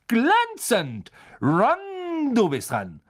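high, drawn-out praise and summons; excited call. A narrator's reading. Teacher praising Dean and summoning Ron, excited call. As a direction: cheer high, then hand the turn over.

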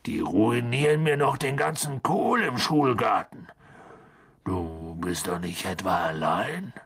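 grumble, then drop to a concerned rumble; Hagrid grumbling. A narrator's reading.

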